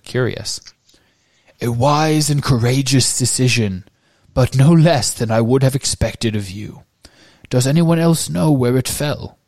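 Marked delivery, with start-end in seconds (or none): 1.6-9.4 put-on kind old wizard praising the boy; Dumbledore voice. A narrator's reading. Dumbledore's portrait praising Harry, gently voiced.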